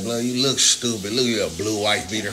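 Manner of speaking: usual speaking voice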